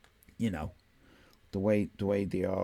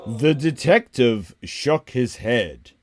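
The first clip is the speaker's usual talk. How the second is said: slow, dramatic narration; story narration; 8 dB louder, slower than usual. A narrator's reading. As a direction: read it like a noir novel.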